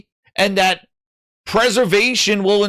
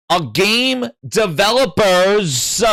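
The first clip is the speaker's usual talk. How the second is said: "A game developers" said slowly, every syllable spelled out; slow and clear; 2 semitones higher, slower than usual. Shown as a demonstration. Streamer repeating it slowly for viewers hard of hearing. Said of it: "one more time for those that are hard of hearing".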